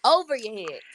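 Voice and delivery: firm, insisting on it; insistent. Retold or imitated. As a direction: repeat it, firmly. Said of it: "That's what my mama said".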